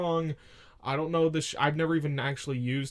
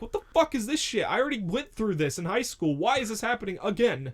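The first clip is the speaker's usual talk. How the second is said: exasperated self-quote; 6 semitones higher than usual. Retold or imitated. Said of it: "I'm like".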